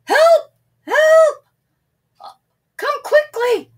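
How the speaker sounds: high, loud panicky yell; shouting. A narrator's reading. Shepherd boy yelling to the village for help. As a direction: scream it like the wolf is right there. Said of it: "he shouted".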